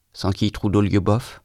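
plain reading voice

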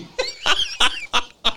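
shrieking burst of laughter; laughing hard at dad's retort. Real, not acted. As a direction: howl with laughter. Cracking up again.

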